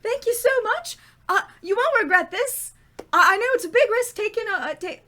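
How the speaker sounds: put-on high, excited Irish accent, gushing thanks. Darlene thanks her new boss, gushing, in a high Irish accent. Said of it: "She has an Irish accent".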